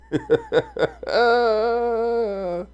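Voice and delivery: high, long real laugh. Real, not acted. Streamer laughs over the Fox Sports comment, high and long. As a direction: burst into a drawn-out high-pitched laugh.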